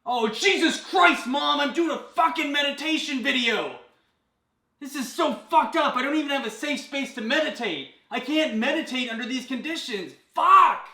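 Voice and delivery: yelling across the room. Acted. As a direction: shriek at mom through the house. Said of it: "yelling at mom across house".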